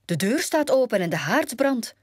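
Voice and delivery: high-pitched alarmed report; calling out. A narrator's reading. Doc warns the dwarfs about their house, calling out. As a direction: call it to the others in surprise. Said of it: "riep Doc".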